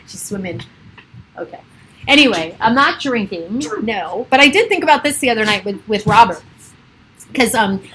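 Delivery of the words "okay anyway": ordinary talk, nothing marked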